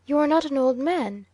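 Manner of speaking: rally the sick man's spirits; a lift in the voice to buck up a frightened servant; rousing encouragement. A narrator's reading. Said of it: "trying to rouse the courier's spirits".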